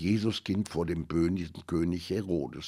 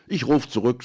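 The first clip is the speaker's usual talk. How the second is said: grumpy; 6 semitones higher, 8 dB louder than usual. A narrator's reading. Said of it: "sagte er mürrisch".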